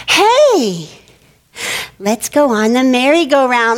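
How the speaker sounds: rally your playground friends, playfully; excited child's suggestion, acted; eager kid calling out. Acting out excited kids' suggestion.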